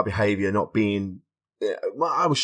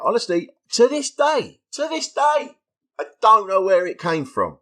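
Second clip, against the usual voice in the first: amazed excitement; 10 semitones higher, 5 dB louder than usual. Retold or imitated. Recalling sudden inspiration, amazed.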